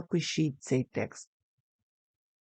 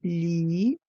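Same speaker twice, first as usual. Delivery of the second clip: syllable by syllable. Shown as a demonstration. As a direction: break Лінійка into лі, ні, йка. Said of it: "слово лінійка на склади".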